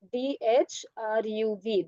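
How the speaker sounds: slow, letter by letter; spelling it out. Shown as a demonstration. Teacher gives a student the boy's name, spelling slowly.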